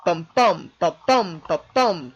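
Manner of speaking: da-DUM heartbeat beats, each second syllable punched; "Bum, bum, ba, bum" like a steady heartbeat, weak then strong; heartbeat rhythm. Shown as a demonstration. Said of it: "mimic the pattern of the human heart".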